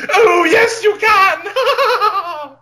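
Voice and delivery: sudden high cackling shout; manic villain shriek. Acted. Villain persona taking over, shrieking with laughter. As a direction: burst in as the gleeful evil alter ego.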